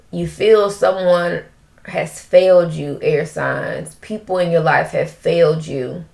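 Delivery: usual speaking voice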